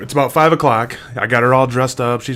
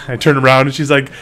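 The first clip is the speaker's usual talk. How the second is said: ordinary talk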